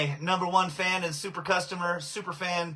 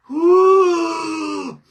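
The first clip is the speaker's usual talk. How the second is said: long, loud, high "woo" of relief; high, drawn-out exhaling whoop; 10 semitones higher, 10 dB louder than usual. Real, not acted. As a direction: blow out a drawn-out, high whoop after coughing. Recovering from a coughing fit.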